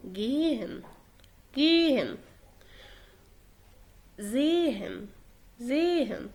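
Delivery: "جيهن" and "زيهن" with only a faint H between the vowels; very light H. Shown as a demonstration. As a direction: barely sound the H in "جيهن" and "زيهن". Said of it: "بننطق حرف الها ولكن خفيف جدًا". (نطق "جيهن" و"زيهن" بهاء خفيفة جدًا)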